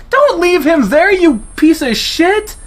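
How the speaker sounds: high, loud furious shout; angry yell. Acted. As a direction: scream the insult after him. Yelling insult at fleeing hero.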